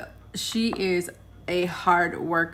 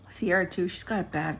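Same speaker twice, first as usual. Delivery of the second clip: ordinary talk, nothing marked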